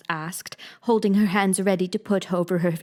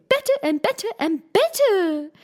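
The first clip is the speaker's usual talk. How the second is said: each better higher until a squeak; "better and better and better" climbing step by step to a squeak; rising to a squeak; 12 semitones higher, 4 dB louder than usual. A narrator's reading. Queen carried away, each "better" pitched higher to a squeak. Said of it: "Her voice went higher with each better".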